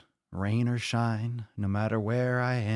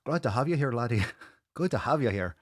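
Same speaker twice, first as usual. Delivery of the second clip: playful higher-pitched Irish lilt, laughing into it; mock Irish accent; 4 semitones higher than usual. Podcast host welcoming a Canadian viewer, mock Irish brogue. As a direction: ham up a jolly Irish welcome. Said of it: "it gets like Irish".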